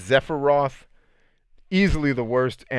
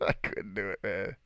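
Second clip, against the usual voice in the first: quiet, helplessly amused; laughing; 10 dB quieter than usual. Real, not acted. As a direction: chuckle through the admission. Laughing at own exasperation.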